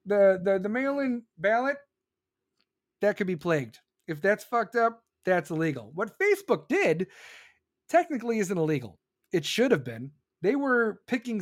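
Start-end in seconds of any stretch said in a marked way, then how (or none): none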